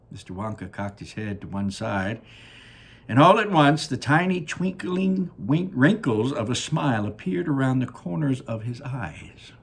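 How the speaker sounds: usual speaking voice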